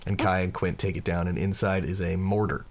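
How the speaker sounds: usual speaking voice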